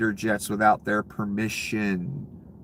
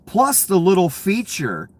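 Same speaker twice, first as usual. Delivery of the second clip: sarcastic; 8 semitones higher, 5 dB louder than usual. Real, not acted. Sarcastic rant.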